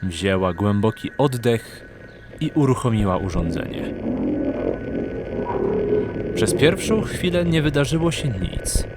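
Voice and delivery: ordinary talk, nothing marked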